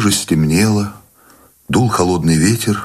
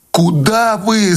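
anguished cry; much slower than usual. A narrator's reading. Wife calling after her departing husband, anguished cry. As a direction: cry out in distress, stretching the words. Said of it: "снова закричала Анеля и зарыдала".

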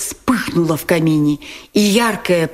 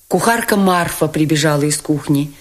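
usual speaking voice

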